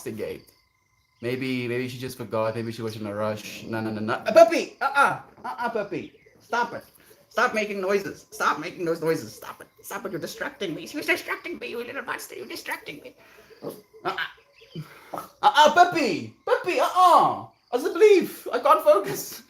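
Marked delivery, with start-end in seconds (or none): 4.3-9.7 high-pitched real scolding of a pet; high, exasperated pet-owner voice; scolding a puppy. Real, not acted. Scolding puppy that interrupts the story. 9.8-13.1 high, softened pet-scolding voice; squeaky, hushed telling-off of a little dog; scolding a puppy. Real, not acted. Pleading with puppy to stop. 14.0-19.4 very high, loud squeal at a puppy; real high-pitched exasperation; exasperated scolding. Real, not acted.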